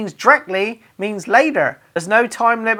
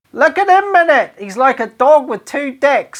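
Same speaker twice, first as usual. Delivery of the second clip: mocking glee; 5 semitones higher, 4 dB louder than usual. Mocking a mate chatting up girls.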